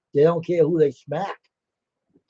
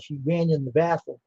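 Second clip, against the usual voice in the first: ordinary talk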